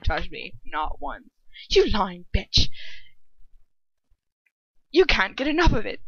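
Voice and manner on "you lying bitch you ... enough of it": louder and higher, spitting rage; furious accusing snarl; enraged snarl. Acted. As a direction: hurl it at her, outraged. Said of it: "he hissed. Enraged".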